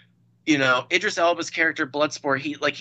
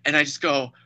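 ordinary talk, nothing marked

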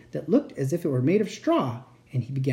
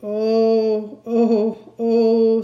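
loud, drawn-out wailing ohs; sobbing; 6 semitones higher, 6 dB louder, much slower than usual. A narrator's reading. Fawn overcome with guilt before Lucy, sobbing. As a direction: wail higher and louder, in acted sobs. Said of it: "sobbed Mister Tumnus".

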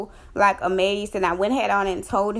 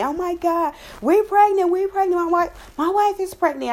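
imitating husband's excited high yell, much higher; retold joyful scream; 9 semitones higher than usual. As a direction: squeal it out like an overjoyed husband on the phone.

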